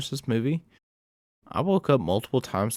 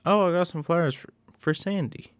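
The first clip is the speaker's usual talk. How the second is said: put-on chirpy girlfriend; 7 semitones higher than usual. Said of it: "She's like".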